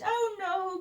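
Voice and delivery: high, storybook cry of dismay; mildly wailing lament; cried out. A narrator's reading. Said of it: "cried George".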